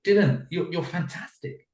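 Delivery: put-on high-pitched praise for a child; glowing praise. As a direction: act thrilled by a child's success. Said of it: "I was praising Dylan".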